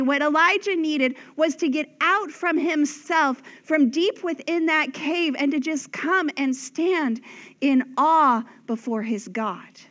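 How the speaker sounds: ordinary talk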